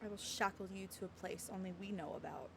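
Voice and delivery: hushed, low character line; soft dialogue reading. Acted. Heroine answering where she'll imprison him, soft and low. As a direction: say it low and softly. Reads game dialogue aloud.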